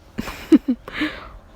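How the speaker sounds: high, breathy acted laugh; mock chuckle. He laughs at being declared dead, a mock chuckle. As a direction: chuckle in character before a comeback. Acting a character's chuckle before retort.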